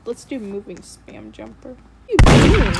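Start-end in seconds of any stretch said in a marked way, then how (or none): 2.1-2.7 high, loud startled yell; startled yelp. Real, not acted. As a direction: yelp in surprise. Startled by murderer.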